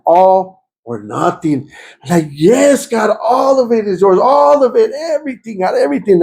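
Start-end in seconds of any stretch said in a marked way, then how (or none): 0.1-1.7 each word slow and weighty; slow, solemn words. Retold or imitated. The voice he heard in worship, slow and solemn. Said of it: "I heard it one more time". 2.3-6.2 screaming. Retold or imitated. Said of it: "You were screaming that".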